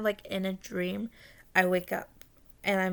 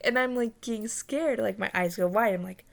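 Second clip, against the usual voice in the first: ordinary delivery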